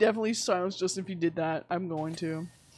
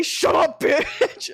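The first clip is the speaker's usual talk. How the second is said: louder, higher joke insult; mock rude snap; 8 semitones higher, 6 dB louder, much slower than usual. Jokingly snapping at someone she can't follow, loud and rude. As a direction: bark it for laughs.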